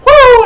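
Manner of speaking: a high, loud Ric Flair woo; high woo yell. Retold or imitated. Imitating Charlotte's woo cry to the crowd, loud and high. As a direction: belt out a shrill woo. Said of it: "And he's like".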